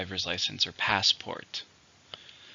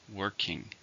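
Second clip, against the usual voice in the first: working with the first syllable "work-" stressed; teacher says working, leaning on "work-"; 8 dB quieter than usual. Shown as a demonstration. Model the word for students, stress on "work-". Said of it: "stresses on the first".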